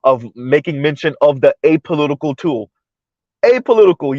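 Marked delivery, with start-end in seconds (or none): 3.4-4.2 pitched-up, incredulous echo of the word; skeptical echo. Real, not acted. Commentator doubting a so-called apolitical tool, skeptical. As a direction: toss the word back high in disbelief.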